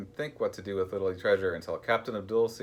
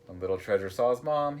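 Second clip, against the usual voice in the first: usual speaking voice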